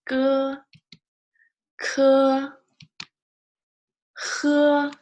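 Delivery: each syllable held loud on one high note; high level syllables. Shown as a demonstration. Teacher drilling sounds for learners, high level tone.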